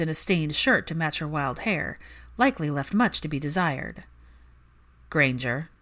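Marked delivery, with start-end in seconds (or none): 5.1-5.7 smooth drawl. Acted. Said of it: "rolled off his tongue like fine wine, smooth and heady".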